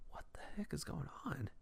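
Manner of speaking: whispered disbelief; stunned whisper. Retold or imitated. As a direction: whisper it under the shock of an insult. Stunned aside after being insulted.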